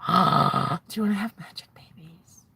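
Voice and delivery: slow, breath-filled whisper after a gasp; awed whisper. Acted. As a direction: whisper it slowly as a magical proposal. Acts magician's awed proposal after gasp.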